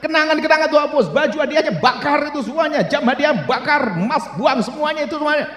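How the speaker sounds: high-pitched, urgent exhortation; full-force preaching. Real, not acted. Preacher orders flock to burn mementos, high and forceful.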